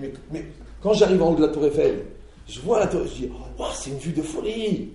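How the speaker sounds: bursting with wonder at the view; mock excited tourist. Visitor atop the Eiffel Tower, loud and amazed. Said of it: "Tu dis".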